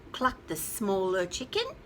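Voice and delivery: usual speaking voice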